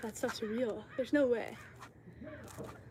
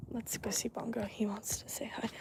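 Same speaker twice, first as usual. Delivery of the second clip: hushed whisper sneaking up on the pet; 5 dB quieter than usual. Real, not acted. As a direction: whisper so the pet won't hear.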